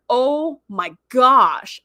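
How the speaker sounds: stunned, long-held reaction; retold shock. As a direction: stretch it slowly in disbelief.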